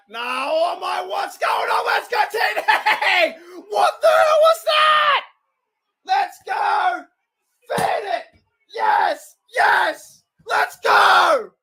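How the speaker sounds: high, loud shouts of delight at the play; excited cheering. Real, not acted. Cheering a Steelers play on screen, real excited shouting. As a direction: yell out like your team just scored.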